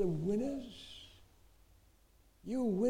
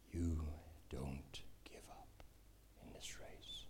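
slow, low whisper to the congregation; hushed, deliberate plea; whispered exhortation; 16 dB quieter, slower than usual. Real, not acted. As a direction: urge them on in a whisper.

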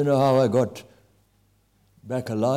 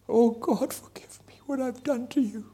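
whispered plea; 11 semitones higher than usual. Retold or imitated. Attacker's confession, pleading forgiveness.

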